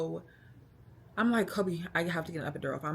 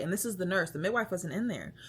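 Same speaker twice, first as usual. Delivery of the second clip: ordinary delivery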